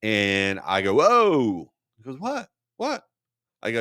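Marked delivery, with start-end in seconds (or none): 0.9-1.7 loud, higher-pitched recoil; startled whoa. Retold or imitated. Recoiling from hand in retold scene. 2.2-3.1 high-pitched, questioning imitation; high little voice, playing innocent; mock puzzled what. Acting the friend's puzzled response.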